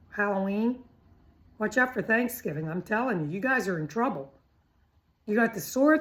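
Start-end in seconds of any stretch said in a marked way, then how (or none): none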